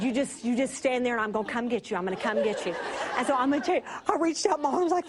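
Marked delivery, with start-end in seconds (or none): 0.0-2.7 calm her, low and steady; husband's voice. Retold or imitated. Husband telling his wife to stay put, low and calming. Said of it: "He said".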